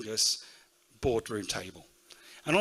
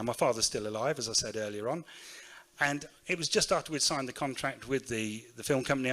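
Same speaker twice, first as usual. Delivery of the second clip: ordinary talk, nothing marked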